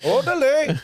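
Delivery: put-on Indian-Mexican accent. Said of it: "my Indian trying to do a Mexican accent".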